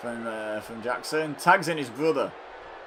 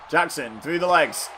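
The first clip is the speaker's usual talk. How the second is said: ordinary delivery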